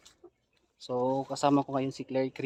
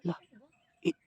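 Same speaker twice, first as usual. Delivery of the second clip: ordinary talk, nothing marked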